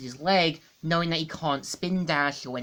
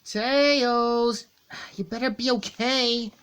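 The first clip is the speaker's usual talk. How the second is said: higher, lightly voiced Sonic calling for his friend; voicing worried Sonic; 6 semitones higher, slower than usual. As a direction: call out for Tails, a bit raised. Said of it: "and him saying".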